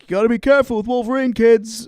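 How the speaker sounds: high, fast, put-on warning to kids; imitated star playfully scolding young fans; mock warning. Imitates actor warning kids, toy in hand.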